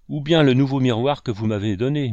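ordinary delivery

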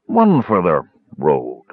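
slow, halting tipsy speech; sway through the words like a drunk; slurring, unsteady big man. Acted. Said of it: "Caramon swayed unsteadily".

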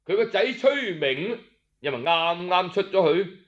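usual speaking voice

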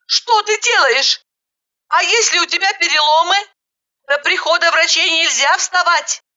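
high, agitated scolding; horrified. A narrator's reading. Woman stopping injured girl from getting up, horrified. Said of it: "Я пришла в ужас".

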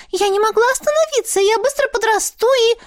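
fast, high breathless excuses; rattling off. Acted. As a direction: babble excuses quickly. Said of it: "затараторила Ларинь".

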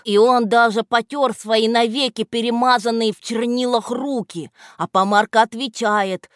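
ordinary delivery

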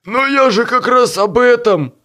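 high, loud pleading. A narrator's reading. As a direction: beg to be heard. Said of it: "взмолился Боб".

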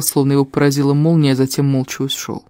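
ordinary delivery, nothing marked

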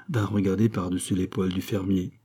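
ordinary delivery, nothing marked

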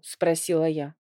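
usual speaking voice

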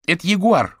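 put-on loud, high-pitched excited kid; boy exclaiming. A narrator's reading. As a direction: blurt it out like an eager boy. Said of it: "воскликнул мальчишка". (звонкий мальчишеский выкрик)

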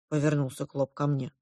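usual speaking voice

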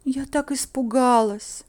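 plaintive, make-believe scare; put-on pitiful. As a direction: play the poor frightened girl. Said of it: "нацепила на лицо жалостливую улыбку".

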